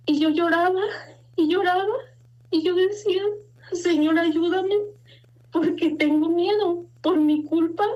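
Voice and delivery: choked up, halting between phrases; tearful. Real, not acted. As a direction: plead through welling tears. Tearfully retells her desperate prayer.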